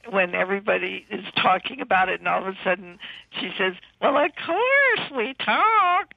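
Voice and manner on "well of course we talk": very high, loud, slow squawk; squawking starlet voice. Retold or imitated. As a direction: squawk like a dim silent-film diva. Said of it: "her squawking, hideous voice".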